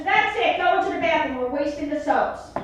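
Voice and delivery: hammed-up mom scolding; mother scolding. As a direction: snap at her like an angry mom. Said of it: "She goes".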